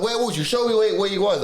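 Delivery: high, loud, insistent demand. Retold or imitated. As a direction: push her hard for the answer.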